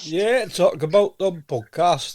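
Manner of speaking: broad mock Northern English, high and loud; Northern English accent. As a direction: ham up the Northern English dialect. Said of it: "you might lose your accent".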